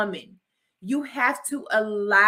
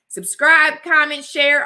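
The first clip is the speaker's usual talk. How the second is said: higher and louder, bright sign-off push; excited outro call; 6 semitones higher, 9 dB louder, slower than usual. Real, not acted. Vlogger urging viewers to subscribe, excited call-out.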